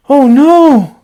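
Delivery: alarmed. Acted. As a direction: gasp it out as a sudden worry. Gerald realises pigs cause sneeze.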